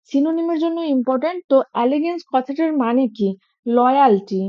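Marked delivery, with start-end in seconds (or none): none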